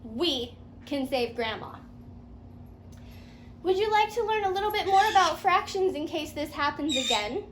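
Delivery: ordinary delivery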